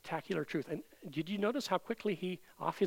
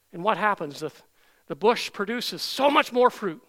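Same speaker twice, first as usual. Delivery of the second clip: loud, higher, ringing scripture proclamation; forceful preaching; 4 semitones higher, 8 dB louder than usual. Real, not acted. Preacher reading Jeremiah's blessing to the congregation, forcefully. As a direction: proclaim it boldly from the pulpit.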